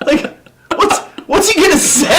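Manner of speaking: very high, cracking up; incredulous laughing squeal; laughing disbelief. Real, not acted. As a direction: ask it through real laughter.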